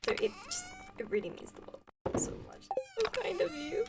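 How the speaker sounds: hushed, airy thanks that lifts high; almost whisper it, overcome with gratitude; touched, near tears. Real, not acted. Streamer telling viewers how much their words mean, touched near tears. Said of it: "You're gonna make me cry".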